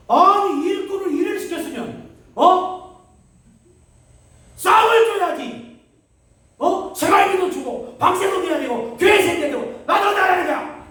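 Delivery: high, loud shouted complaint; retold furious shout. Pastor reenacting yelling at God over unpaid rent, shouting. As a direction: yell your grievance at heaven.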